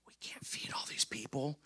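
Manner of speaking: hushed, whispered worry; worried whisper. Retold or imitated. Disciple whispering to Jesus about the crowd. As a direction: lean in and whisper the bad news.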